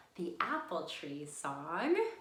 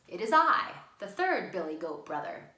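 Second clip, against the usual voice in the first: calling out proudly; 9 dB louder than usual. Acted. Big goat calling out to the troll, loud and proud. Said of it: "So he calls out".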